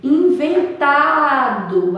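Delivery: slow "inventado", swelling on TA; stress on TA. Shown as a demonstration. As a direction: hold and lift the TA in "inventado". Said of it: "fica mais falando nela".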